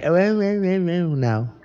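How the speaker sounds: high, mock eager "oh, oh"; mocking Salim. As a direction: imitate a classmate's silly eager noises. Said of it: "Salim is like".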